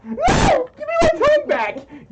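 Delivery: very high, loud wailing protest; mock distressed scream. Streamer whose tongue is grabbed, mock wailing scream. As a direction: shriek it in hammed-up distress.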